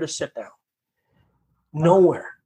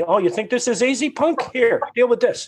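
higher, taunting voice of God calling him punk; mocking God voice; 9 semitones higher than usual. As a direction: tease like God putting him in his place. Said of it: "It was like God said to me".

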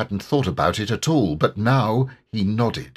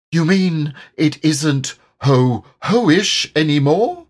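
higher, slow and hopeful; hopeful Piglet; 6 semitones higher, 5 dB louder, slower than usual. A narrator's reading. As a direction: lift it with hope. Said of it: "said Piglet hopefully".

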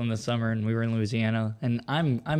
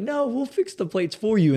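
insistent hosts; 11 semitones higher, 5 dB louder, slower than usual. Retold or imitated.

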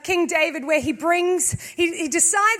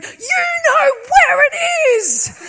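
high, loud, desperate mock yell; hammed-up exasperated scream; yelling at God; 9 semitones higher, 6 dB louder than usual. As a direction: imitate a frantic shout up to heaven. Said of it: "Lisa was there yelling at God".

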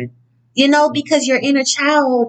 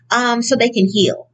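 ordinary delivery

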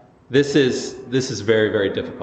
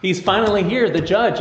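excited; 6 semitones higher than usual. Acted. Preacher builds excitement about Jesus.

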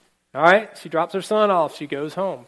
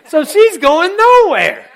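high, loud and slow, wailing in frustration; frustrated wail; 14 semitones higher, 12 dB louder, slower than usual. Acted. Preacher wailing about Jean stuck in traffic, high loud moan. Stuck behind two trucks.

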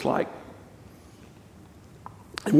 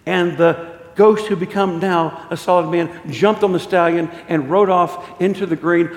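normal talking voice

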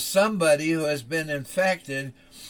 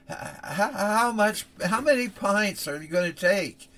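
higher, halting panic; stammering fear; 6 semitones higher than usual. Acted. Father asking the doctor how much blood, stammering. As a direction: stumble out the question in dread.

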